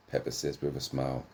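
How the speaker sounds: ordinary delivery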